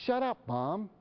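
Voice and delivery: put-on high-pitched teen backtalk; defiant adolescent. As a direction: imitate a kid suddenly mouthing off at mom. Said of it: "suddenly turns and goes".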